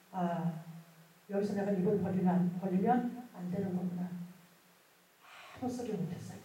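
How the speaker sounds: normal talking voice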